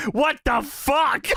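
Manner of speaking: high, slow drawn-out mock outrage; mock outburst. Host imitating Jerry Lynn flipping out at the kid, high and slow. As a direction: stretch it out like a wrestler losing it. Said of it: "Jerry starts flipping. He says".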